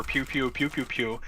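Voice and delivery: mouthed blaster pew pews; laser sound effects. Pretend. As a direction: spit out quick laser zaps.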